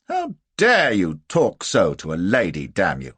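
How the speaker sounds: loud, outraged challenge; indignant rebuke. A narrator's reading. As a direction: tell him off for insulting a lady. Hero rebukes the German.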